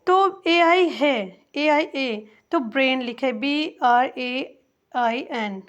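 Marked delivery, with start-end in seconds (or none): none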